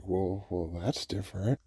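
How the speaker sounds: low, quiet aside to himself; understated reaction. Retold or imitated. Muted reaction to drifting ambulance.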